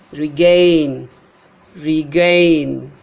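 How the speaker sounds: regain as ri-GAIN, long GAIN; light re, punched GAIN in regain; second-syllable stress. Shown as a demonstration. Teacher showing unstressed prefix, regain stressed on GAIN. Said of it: "The syllable gain is trust".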